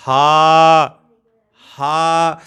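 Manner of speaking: a voiced guttural ہا, demonstrated; throat letter demo. Shown as a demonstration. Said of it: "نیچے والے حصے کا مطلب".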